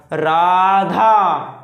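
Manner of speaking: राधा with the final आ held very long and loud; drawn-out pluta vowel. Shown as a demonstration. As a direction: stretch राधा out slowly, holding the last vowel. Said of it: "ये ऐसे पढ़ा जाएगा".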